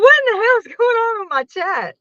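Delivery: exasperated high cry at the chat; retold shock. Streamer recalling trolls, crying out in high disbelief.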